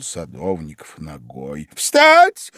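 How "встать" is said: very high, loud command; piercing shout. A narrator's reading. Queen orders the gardeners up, piercing shout. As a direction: shriek it like a drill order. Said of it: "пронзительно крикнула королева".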